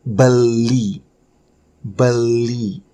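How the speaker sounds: "Beli" slow and emphatic, both syllables drawn out. Shown as a demonstration. Teacher introducing the verb for buying, slowly sounded-out "Beli". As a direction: stretch "Beli" into "be-lii".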